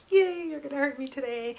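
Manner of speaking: high, loud fake-excited "yay"; mock cheer. Patient greeting the machine that will hurt her, mock cheer.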